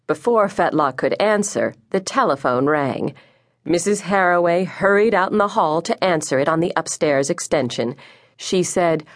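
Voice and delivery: ordinary delivery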